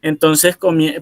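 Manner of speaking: ordinary delivery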